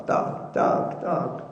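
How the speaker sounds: plain reading voice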